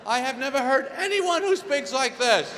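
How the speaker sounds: loud, high-pitched indignation; outraged husband. Acted. Husband objecting to wife's imitation of him, loud outrage. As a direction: shout in baffled protest.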